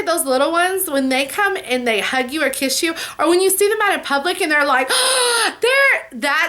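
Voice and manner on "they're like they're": high gasp and squeal, imitating a child; excited child gasp. Vlogger imitating a child excitedly spotting her in public. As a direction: mimic a kid spotting you in public.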